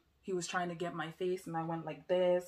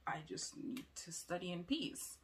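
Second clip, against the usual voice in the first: whispered quote; 7 dB quieter than usual. Retold or imitated. Retold wish for quiet study.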